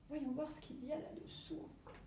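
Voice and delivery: soft, secretive "voyons voir"; hushed peek. Pretend. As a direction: whisper in suspense. Peeking under cover, suspense. (voix feutrée pleine de suspense)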